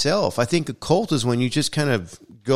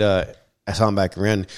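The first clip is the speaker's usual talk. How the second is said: normal talking voice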